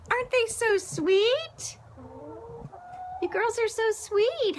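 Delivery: high, slow cooing voice. Real, not acted. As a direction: coo at them like pets. Cooing to chickens.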